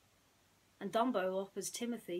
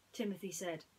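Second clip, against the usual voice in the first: usual speaking voice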